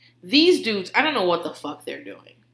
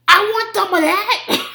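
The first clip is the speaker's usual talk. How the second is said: high, loud, slow mock demand; imitating aggressive toddler; 11 semitones higher, 8 dB louder, slower than usual. Three-year-old waving arms at mommy, aggressive toddler yell imitation. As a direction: bellow it as a pushy little kid. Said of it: "He's just so fucking aggressive".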